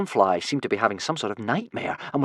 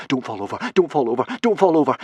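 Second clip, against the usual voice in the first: begging himself, more desperate each time; begging, building; 3 semitones higher, faster than usual. A narrator's reading. Hiccup running on ice begs himself not to fall, growing panicked. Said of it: "begged Hiccup".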